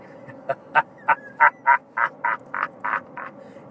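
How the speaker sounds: low evil villain laugh. Acted. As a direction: let out a menacing villain laugh.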